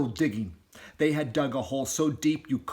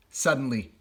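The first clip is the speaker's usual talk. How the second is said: ordinary delivery, nothing marked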